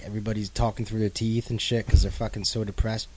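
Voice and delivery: usual speaking voice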